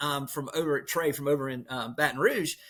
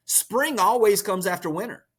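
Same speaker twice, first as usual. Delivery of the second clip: emphatic; 6 semitones higher, 4 dB louder than usual. Real, not acted. Emphatic motivational point.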